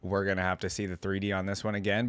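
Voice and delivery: normal talking voice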